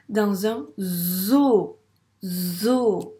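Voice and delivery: "zoo" loud and stretched, with a long buzzed Z; slow right down and lean on the Z of "zoo"; Z appuyé. Shown as a demonstration. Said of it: "est-ce que tu entends le son Z". (« zoo » très allongé, Z sonore)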